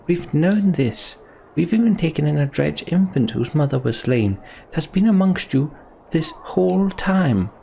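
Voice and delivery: lightly voiced leader arguing for mercy; earnest leader pleading. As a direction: defend the refugees to the crowd.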